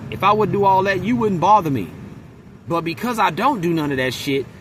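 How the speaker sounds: ordinary delivery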